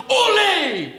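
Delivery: high, drawn-out cheer of OLE; soaring, long-held shout; crowd's yell. Acted. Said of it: "leaps to its feet as yells".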